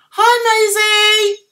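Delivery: high, loud hello; called-out greeting. Real, not acted. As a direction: sing out a big drawn-out welcome. Greeting a viewer who joins.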